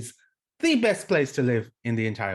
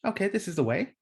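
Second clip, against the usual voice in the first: client voice; 7 semitones higher, faster than usual. Retold or imitated. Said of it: "And the client goes".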